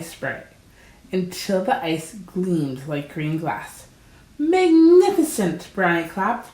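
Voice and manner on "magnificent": high, loud burst of delight; delighted cheer. A narrator's reading. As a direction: cheer him on with wonder. Brownie cheers the fox's skating.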